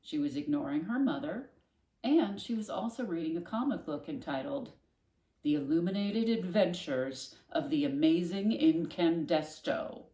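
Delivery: usual speaking voice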